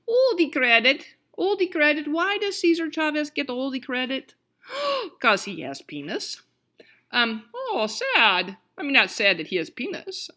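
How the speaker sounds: playful Russian accent, indignant and then mock-sad; weird Russian accent. Lecturer griping about who gets the credit, weird Russian accent. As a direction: slip into a hammed-up Russian accent. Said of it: "slipped into my weird Russian accent".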